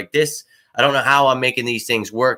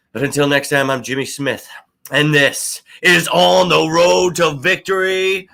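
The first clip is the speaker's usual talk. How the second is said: show host sign-off; 5 semitones higher than usual. Acted. Signs off with show name.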